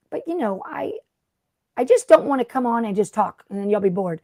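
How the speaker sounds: ordinary talk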